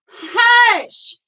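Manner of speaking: sharp high hush; hushing the dogs. Real, not acted. Reader hushing her dogs mid-reading, sharp high hush. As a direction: shush the barking dogs.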